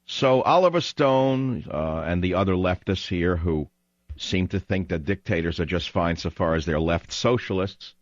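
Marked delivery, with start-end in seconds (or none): none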